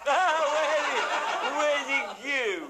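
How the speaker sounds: repeating where's it goo while cracking up, high and slow; laughing through it. Retold or imitated. Storyteller retelling the farmer's odd question, laughing.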